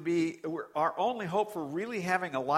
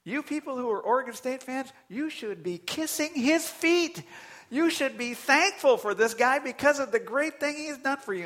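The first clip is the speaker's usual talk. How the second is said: scolding rebuke; 10 semitones higher, 5 dB louder than usual. Real, not acted. Preacher scolds fans.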